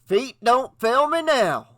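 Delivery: high, alarmed exclamation; comic panic. A narrator's reading. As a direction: cry it out as if about to bolt. Ned panics at the snake's size.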